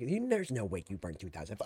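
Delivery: hammed-up imitation sinking low; exaggerated stepmom mimicry. Streamer mimicking stepmom doubting calorie count, hammed-up disbelief. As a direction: overdo the disbelief, dropping into a low garble. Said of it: "She didn't say it like that".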